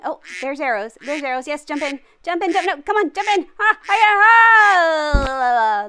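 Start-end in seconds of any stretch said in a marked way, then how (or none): 2.2-5.9 rising panicked cry. Real, not acted. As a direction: scream as it catches you. Duck catches player.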